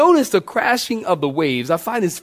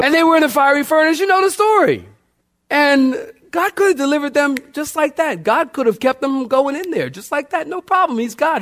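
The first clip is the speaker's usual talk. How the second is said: high, fast, building excitement; climbing preacher zeal; rising preacher fervor; 8 semitones higher, faster than usual. Real, not acted. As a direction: lift it up fast like the church is with you.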